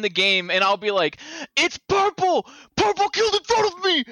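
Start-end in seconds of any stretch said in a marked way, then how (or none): 1.6-4.0 high urgent call-out; high excited accusation. Retold or imitated. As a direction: shout out the killer, high. Retold accusation in game.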